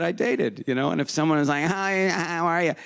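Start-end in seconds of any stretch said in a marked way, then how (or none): 1.6-2.7 slow, nasal "Hi, how are you?" pushed up into the nose; nasal greeting. Retold or imitated. Recalling a date who talked up in her nose, slow nasal hello. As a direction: greet through the nose, drawn out. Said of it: "talk, I guess, up in your nose".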